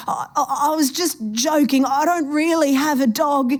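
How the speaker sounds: high, nervous, stammered backpedal; frightened pleading. A narrator's reading. Trapped man begging the old woman, high nervous stammer. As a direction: scramble to take it back, scared.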